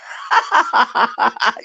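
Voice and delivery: long, rapid run of laughs; real laughter. Real, not acted. Vlogger reacting to a viewer's comment, real laughter. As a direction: crack up mid-chat.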